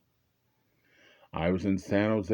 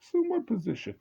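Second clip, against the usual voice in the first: confused. Retold or imitated. Speaker's confused reply to cop.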